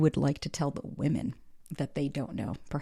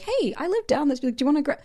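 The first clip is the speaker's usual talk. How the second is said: perky imitated come-on, pitched up; acted friendly invitation; 9 semitones higher than usual. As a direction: re-enact a spontaneous invite to someone new. Acts out a casual invitation.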